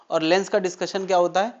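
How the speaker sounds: ordinary talk, nothing marked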